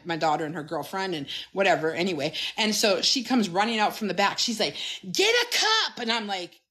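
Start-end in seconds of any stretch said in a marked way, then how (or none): 5.1-6.0 high squealed order; high mock shriek without much volume; imitated excited scream. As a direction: mimic a thrilled barista, pitched way up. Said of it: "screaming, like, screaming".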